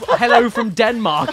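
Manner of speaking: slow, laughing American accent; drawled-out American greeting, hammed up. Shown as a demonstration. Streamer to partner, demo in a laughing American accent. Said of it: "in an American accent".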